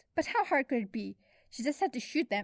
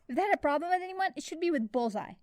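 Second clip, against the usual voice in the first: ordinary delivery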